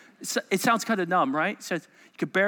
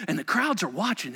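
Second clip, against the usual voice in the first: ordinary talk, nothing marked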